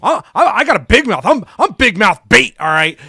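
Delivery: offended big-mouth shout; pissed-off comeback. Retold or imitated. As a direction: bark it back like an insulted wrestler. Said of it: "Tyler Bate gets pissed".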